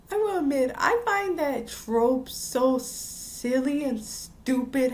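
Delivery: ordinary delivery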